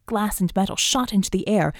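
plain reading voice

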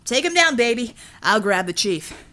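fierce female fighter voice; Shakti's voice. Acted. Shakti's combat call, voiced.

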